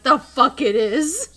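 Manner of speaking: retold comeback, belted out slowly; indignant retort. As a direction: blurt it out in loud disbelief. Retold argument with a customer.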